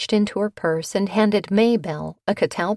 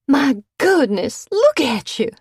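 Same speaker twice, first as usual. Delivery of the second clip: high, slow mock-horrified drawl; faux horror drawl; 8 semitones higher, 6 dB louder than usual. A narrator's reading. Bitsy to the cat, in mock horror. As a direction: gasp it out in hammed-up dismay. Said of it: "Bitsy drawled in faux horror".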